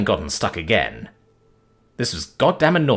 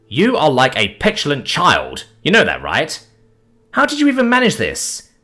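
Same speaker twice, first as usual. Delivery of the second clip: much higher, louder, mocking disbelief; teasing dragon; 8 semitones higher, 4 dB louder than usual. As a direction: needle him like an amused dragon. Dragon teases Tom.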